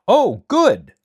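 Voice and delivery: high, loud burst of delight; thrilled high exclamation; excited exclamation. Acted. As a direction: cry out with sudden joy. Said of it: "exclaimed the Tin Woodman".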